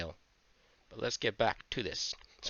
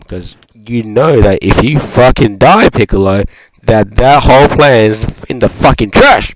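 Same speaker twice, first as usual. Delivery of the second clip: very loud, ranting outburst; exasperated shouting; 24 dB louder than usual. Real, not acted. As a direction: yell it in outrage at a stupid plan. Ranting at Piccolo's dumb plan.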